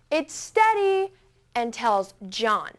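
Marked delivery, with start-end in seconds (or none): 0.1-1.1 higher, slow call across the river; yelled over. Retold or imitated. Dad across the log calls out to the scared girl, yelling slowly. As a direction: call out steady and reassuring from afar. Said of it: "he yells over".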